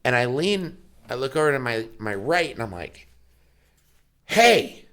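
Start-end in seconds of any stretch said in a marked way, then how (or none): 4.2-4.9 sharp, high, loud dad call; stern dad voice. Retold or imitated. Dad catching his four-year-old misbehaving, stern and loud. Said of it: "dad voice and face".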